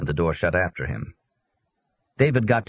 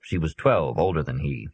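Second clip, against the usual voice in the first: usual speaking voice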